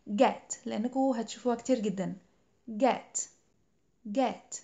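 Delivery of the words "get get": "Get." with a clear short e vowel; careful pronunciation. Shown as a demonstration. Teacher drills the key word get for students. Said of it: "تأكدوا إنكم بتنطقوها صح".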